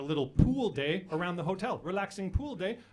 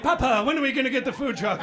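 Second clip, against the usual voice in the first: higher, louder pleading child imitation; bored kid; 6 semitones higher, 6 dB louder than usual. Adopted Cuban kid nags papa, bored kid voice. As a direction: pester papa as a bored boy. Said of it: "He's bored to tears".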